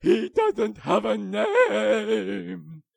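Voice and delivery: very loud, very slow, high wail; wailing cry. Acted. Willy fleeing into his cave, a loud slow wail. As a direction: howl it in panic as he runs.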